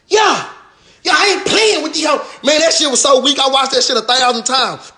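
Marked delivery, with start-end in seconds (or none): 0.1-2.1 high, hammed-up tough-guy yell; mock tough shout. Mocking the attacker walking to the car, high shout. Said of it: "walk to the car and say".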